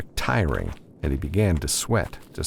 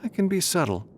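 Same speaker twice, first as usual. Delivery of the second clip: much higher, defensive retort; 12 semitones higher than usual. A narrator's reading. Sharon defends herself to Matthew, higher and defensive. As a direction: push back, a bit wounded. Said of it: "she said defensively".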